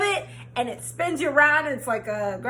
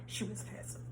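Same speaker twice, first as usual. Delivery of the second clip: whispered aside; 4 semitones lower, 20 dB quieter than usual. Real, not acted. Whispered aside about friend.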